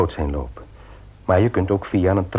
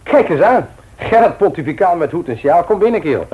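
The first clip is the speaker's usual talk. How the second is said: hearty greeting; 9 semitones higher, 4 dB louder than usual. Acted. Greeting Gert at the door.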